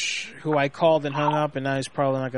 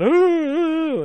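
very high, loud drawn-out "ooh"; goofy "ooh"; 13 semitones higher, 6 dB louder than usual. Retold or imitated. Husband clowning for his wife in the car, high goofy hooting. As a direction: hoot two long falsetto "oohs". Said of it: "and I went".

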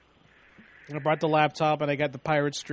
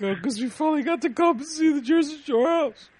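much higher, gushing fake fan voice; mock fan excitement; 10 semitones higher, 3 dB louder than usual. Teasing the crowd at the Jersey Shore house, mock starstruck. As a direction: play the starstruck tourist for laughs. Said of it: "I'm like".

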